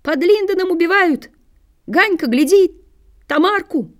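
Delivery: high, excited shouting; high-pitched alarmed call; children yelling. A narrator's reading. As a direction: yell the news across the hill like excited kids. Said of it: "Орут".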